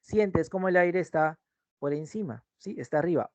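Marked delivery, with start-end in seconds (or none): none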